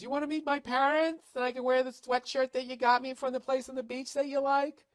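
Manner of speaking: put-on high, sweet girlfriend voice; mock girlfriend voice. Man mimics girlfriend asking him to meet her parents. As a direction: play her as a hopeful girlfriend. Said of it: "when she goes".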